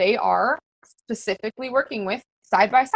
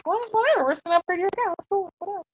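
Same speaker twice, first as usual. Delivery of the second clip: high, offhand and casual, trailing off quietly; mock nonchalant coach; breezy whatever; 8 semitones higher, 4 dB quieter, much faster than usual. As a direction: shrug it off like it's no big deal. Casually quoting herself to recruits.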